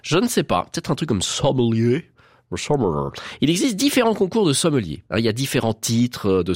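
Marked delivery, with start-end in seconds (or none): 1.2-3.1 put-on English accent on sommelier, second try lower. Shown as a demonstration. Host guessing how sommelier sounds in English, English accent. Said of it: "avec l'accent anglais".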